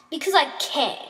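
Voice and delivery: mildly shouted; shouted retort. A narrator's reading. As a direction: throw it back defiantly. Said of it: "she shouted".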